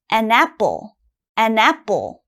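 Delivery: "an apple" blended, n carried onto apple; linked as one word. Shown as a demonstration. Teacher repeating the linked form for the class, blended. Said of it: "it has to sound almost like one word".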